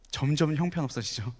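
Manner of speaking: ordinary talk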